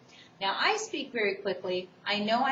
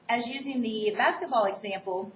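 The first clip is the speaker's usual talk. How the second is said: ordinary talk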